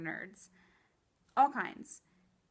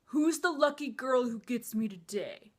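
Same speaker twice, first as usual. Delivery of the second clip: cocky jock; 3 semitones higher, 7 dB louder than usual. Acted. Nerd fan mocks tough guys and jocks, in a loud cocky jock voice. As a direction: put on a self-adoring jock's swagger, louder. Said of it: "With tough guys and jocks".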